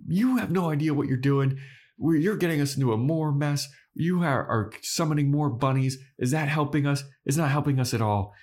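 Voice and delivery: mild mock yell at Anya; mock yelling Giles. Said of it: "Giles is now yelling at Anya".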